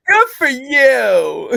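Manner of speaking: high, loud and very slow, sing-song teasing; mock echo. Friend echoes the teasing congratulations in a high, slow, loud sing-song. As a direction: stretch it out high to tease the friend.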